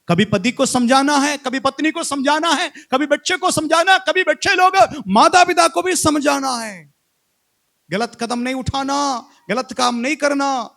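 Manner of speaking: high, loud rhythmic exhortation; forceful preaching. Real, not acted.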